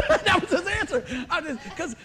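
high laugh through the words; words break into a high laugh. Real, not acted. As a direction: laugh out loud at the memory. Laughing at friend's reply.

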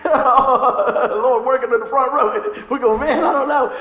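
talking loudly through laughter; real laughing aside. Real, not acted. Laughs at front row's reaction.

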